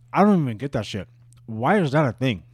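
usual speaking voice

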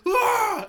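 sudden high, loud "Ah!"; mock scare roar. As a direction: jump out at them with a yell. Re-enacts jumping out to scare.

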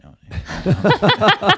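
squeaky high laugh on the words. Real, not acted. Guest laughing at his client's lame excuse, laughing. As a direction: burst into a giggle.

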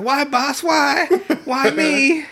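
squeaky high, laughing plea; cartoon goon whining as he dies; mock dying wail. Dying bandit's last words.

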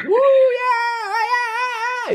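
high, drawn-out whoop of joy; long high-pitched "woo, yeah" cheer. Retold or imitated. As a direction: cheer like a fan at a big win. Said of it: "we were both, like, cheering".

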